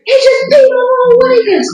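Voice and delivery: very high-pitched mock wail; squealed retold complaint; retold distressed wail. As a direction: wail it down the phone to mom, hammed up. Retold upset call to mom.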